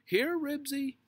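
high, loud yell to a dog; yelled call. A narrator's reading. Henry stuck in thorns calling Ribsy, high yell. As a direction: yell for the dog at full pitch. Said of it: "he yelled".

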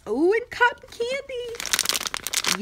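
very high, drawn-out squeal of delight; slow, loud, high-pitched thrill; excited. Real, not acted. Finding cotton candy in the box, excited. Finds a favourite sweet in the box.

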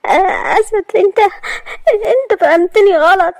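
high, sobbing plea; crying. Acted. Maria crying as she pleads with Asad. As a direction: beg him through tears. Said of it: "وهي بتبكي".

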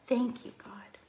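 soft whispered prayer; hushed whisper; whispered thanks. Acted. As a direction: breathe it as a prayer.

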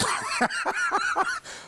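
short real laugh; amused laugh at the situation. Real, not acted. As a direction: burst out laughing. Laughs after the bluff.

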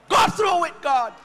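fervent sermon shout; preaching shout. Acted. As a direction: ring out the refrain.

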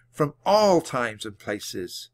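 ordinary delivery

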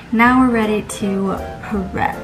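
ordinary delivery

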